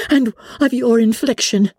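high-pitched, forceful retort; energetic accusation. A narrator's reading. Elizabeth blames Darcy for Wickham's misfortunes, energetic. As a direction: come straight back at him, pitched high. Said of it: "cried Elizabeth with energy".

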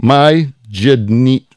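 "Bemajidni" sounded out slowly, piece by piece; halting, broken-up attempt at "Bemajidni"; struggling to pronounce. Real, not acted. Host reading out listeners' towns, struggling to pronounce one. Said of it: "I don't even know how to say it".

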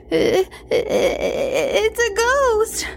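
high, halting frightened squeal; terrified stammer. A narrator's reading. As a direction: stutter it out in fear. Terrified child stammers at ghost.

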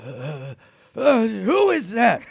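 high, groaning sleep-talker; groan, then blurt it like a dreamer; imitated sleep talk. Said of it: "She recorded me one time being like".

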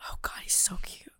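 breathy whispered gush; whispered crush confession; swooning whisper. Real, not acted. Swooning over Chet Hanks.

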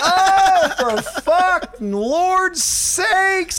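drawn-out high mock exasperation; exasperated. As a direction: groan it out in disbelief. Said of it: "And I'm like".

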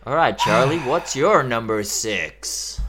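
loud American host opener, slowing down; American host accent. Pretend. Host asks co-host for their number six, American accent. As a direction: put on an American presenter's accent. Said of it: "why you doing American host?".

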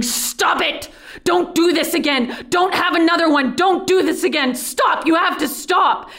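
high-pitched, desperate pleading yell; urgent acted outcry, each order hammered out. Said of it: "and I'm yelling".

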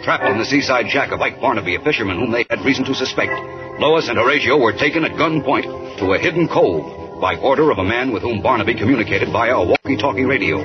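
ordinary delivery

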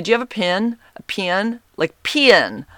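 "pin" for pen, stretched to "pee-in". Retold or imitated. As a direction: drag "pin" into two twangy syllables. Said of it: "he would call them pins".

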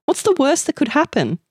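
high-pitched teasing friends; mocking. A narrator's reading. Friends teasing Amanda about public toilets, mocking. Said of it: "they mocked".

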